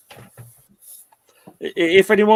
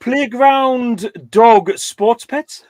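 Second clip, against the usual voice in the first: slow, title words in reverse order; backwards speech; 7 semitones higher, 4 dB louder, much slower than usual. Shown as a demonstration. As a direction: read the title back to front slowly. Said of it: "I understand you better now than when you speak normally".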